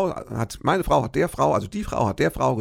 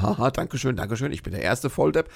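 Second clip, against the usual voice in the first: ordinary talk, nothing marked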